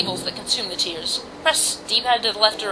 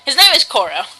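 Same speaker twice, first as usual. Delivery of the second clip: louder, triumphant his name is Koro; excited exclamation; 5 semitones higher, 9 dB louder than usual. Real, not acted. Player answering his own question, triumphantly louder. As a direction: announce it like a small victory.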